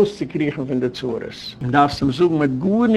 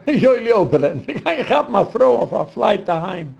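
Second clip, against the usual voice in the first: talking through laughter, pitched higher; laughing; 5 semitones higher than usual. Real, not acted. Speaker joking about catching a flight home, laughing. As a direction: crack up while saying it.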